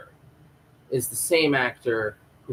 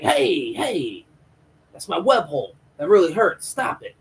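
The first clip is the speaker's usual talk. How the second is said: acted yelps of objection, pitched up; mock pained protest; 6 semitones higher, 3 dB louder than usual. Guy stopping M M shoving drugs into his web hole, mock pained protest. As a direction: protest as if being hurt, hammed-up.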